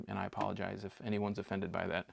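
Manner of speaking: usual speaking voice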